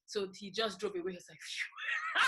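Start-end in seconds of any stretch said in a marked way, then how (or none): 1.4-2.2 high, airy mock sigh of relief; imitating a breathless phew of relief; relieved phew. Relief after escaping police.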